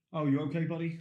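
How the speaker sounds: easy, unrushed reply; unhurried. Acted. Replying to a friend's call, unhurried. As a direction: answer as if in slow motion. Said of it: "in that kind of, like, slow motion".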